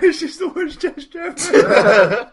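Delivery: high, slow, whimpering panic; panicked squeal. Acted. Barsher squeals in terror squirming through debris. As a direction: squeal it out half-laughing in terror.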